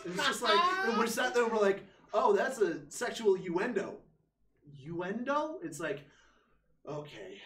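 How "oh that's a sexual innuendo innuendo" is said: excited explaining. Retold or imitated. Explaining innuendo to German friend.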